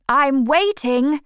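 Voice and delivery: high, slow call from the studio door. A narrator's reading. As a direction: call the two men in from the garden. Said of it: "he cried".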